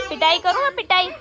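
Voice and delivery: loud, high threat of a spanking; threatening. Real, not acted. Mother threatening to spank the fighting kids.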